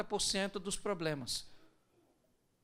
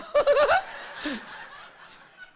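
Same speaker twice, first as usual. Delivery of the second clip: high loud laugh; 9 dB louder than usual. Real, not acted. Laughs at own irony joke.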